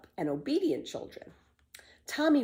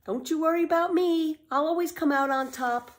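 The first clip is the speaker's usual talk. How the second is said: high, loud call up to the sky; calling up to heaven; 10 semitones higher, 3 dB louder than usual. A narrator's reading. Pippi calls up to her mother in heaven, high and loud. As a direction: call up cheerfully to mother above.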